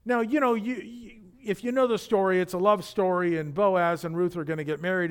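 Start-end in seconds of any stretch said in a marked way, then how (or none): none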